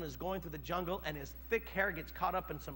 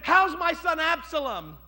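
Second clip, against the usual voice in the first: anxious father; 10 semitones higher, 12 dB louder than usual. Acted. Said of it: "He said".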